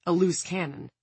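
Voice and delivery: ordinary delivery, nothing marked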